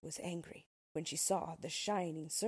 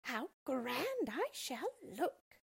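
high, slow, smug witch voice; gloating witch; 6 semitones higher, much slower than usual. Acted. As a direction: savour it like a vain witch. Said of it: "she thought".

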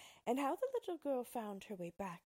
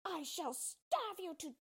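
high, loud shrieking witch threat; piercing witch yell, slowing on the threat; shouting witch; 4 dB louder than usual. A narrator's reading. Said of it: "shouted at him".

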